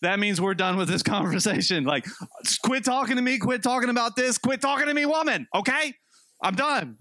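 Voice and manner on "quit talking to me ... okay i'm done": pitched up, exasperated husband cutting her off; mock exasperated outburst. Husband to his wife, ending the talk, mock exasperated. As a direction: wave her off, half-joking irritation.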